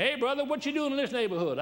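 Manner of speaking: high called-out challenge; imitating a yell. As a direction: call out across the lot, pitched high. Stranger yells at gas station.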